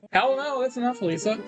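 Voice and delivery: alarmed refusal. Acted. Sarai begs Lisa not to hit harder, alarmed refusal. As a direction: refuse another hit in a high voice.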